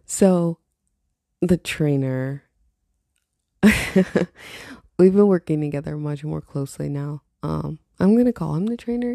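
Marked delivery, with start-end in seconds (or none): none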